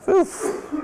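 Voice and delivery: loud, breath-only puff; exhaled "oof". Acted. Teacher to learners after a long list of rules, loud puffed oof. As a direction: blow out an oof, as if worn out.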